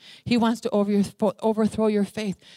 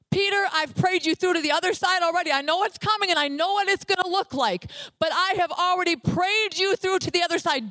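higher, earnest voice of Jesus promising Peter; Jesus reassuring Peter; 7 semitones higher than usual. Acted. Jesus telling Peter he prayed him through, higher and earnest. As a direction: lift the voice as Jesus assuring Peter he is covered. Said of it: "Jesus himself".

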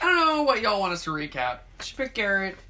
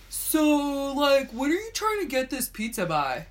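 mocking pizza clerk; 4 semitones higher, slower than usual. Mocking the pizza place caller.

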